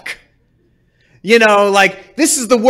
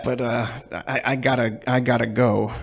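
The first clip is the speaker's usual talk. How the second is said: sheepish mumble; 9 semitones lower, 10 dB quieter than usual. Pretend. Soldier quietly backing out.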